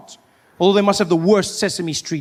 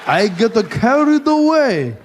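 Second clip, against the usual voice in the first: slow English in a put-on Japanese accent; slower than usual. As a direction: keep slipping into a Japanese accent. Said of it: "speak English with a Japanese accent".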